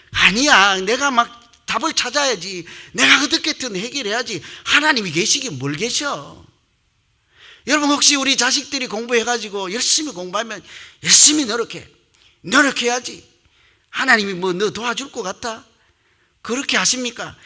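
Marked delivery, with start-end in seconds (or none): 0.1-6.4 higher, mock-defiant self-talk; acted defiance. 믿음이 흔들린 사람의 혼잣말. 11.0-15.6 higher-pitched acted parental nagging; scolding parent. 자녀를 다그치는 부모 재연.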